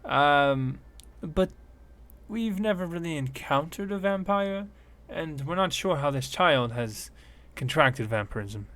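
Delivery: a worried little scientist begging; gnome woman pleading. Acted. Gnome woman explains the problem.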